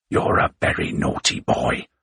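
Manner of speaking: breathy mock scolding; teasing retort. A narrator's reading. Aide taunting his boss, mock scold. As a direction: tease him, half whispered. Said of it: "Pope retorted".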